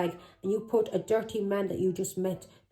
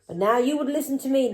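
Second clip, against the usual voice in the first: normal talking voice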